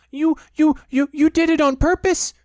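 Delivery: high, loud spluttering stammer; spluttering Piglet. A narrator's reading. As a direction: sputter through soap. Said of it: "spluttered Piglet".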